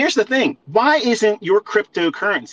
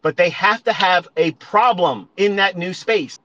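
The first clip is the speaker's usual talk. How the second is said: ordinary talk, nothing marked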